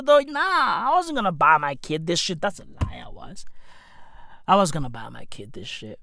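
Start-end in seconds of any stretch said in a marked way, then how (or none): 2.4-3.5 a hushed aside owning up; whispered confession. Real, not acted. Dad admitting to viewers in a whisper. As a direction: whisper it, a sheepish admission.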